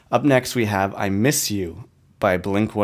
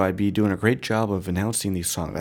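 normal talking voice

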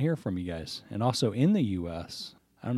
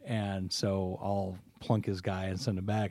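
ordinary talk, nothing marked

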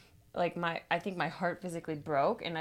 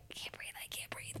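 breathless whispered panic; softer whispered retake of the panic; 14 dB quieter than usual. Retold or imitated. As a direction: gasp it out under your breath. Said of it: "I'm repeating myself that I can't breathe".

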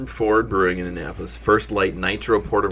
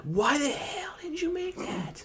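hushed mock disbelief, breathy and high; incredulous whisper; 9 dB quieter, much slower than usual. Asking the brewery why they dropped it, in a whisper. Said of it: "and we were like".

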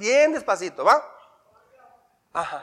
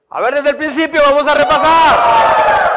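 calling out; 5 semitones higher, 5 dB louder than usual. Real, not acted. Teacher calling class to review.

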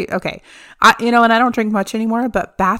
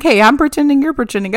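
customer service voice; 6 semitones higher, 5 dB louder, faster than usual. Retold or imitated. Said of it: "I'm like".

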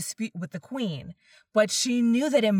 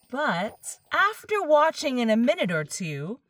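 ordinary delivery

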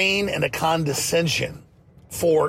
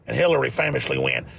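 ordinary talk

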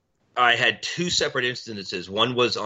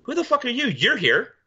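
mock retort; 13 semitones higher than usual. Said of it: "And I look down and go".

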